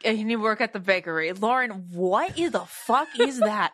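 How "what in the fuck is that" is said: amazed disbelief. Real, not acted. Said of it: "how does [name]' voice just come out".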